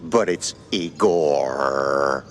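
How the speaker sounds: "Igor" said slowly and carefully; slow correction. Shown as a demonstration. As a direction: spell out "Igor" with patience. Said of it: "you said Igor".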